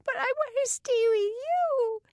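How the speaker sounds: put-on very high little girl's wail; a small girl wailing to stay with her brother; long, high tearful wail. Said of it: "she wailed".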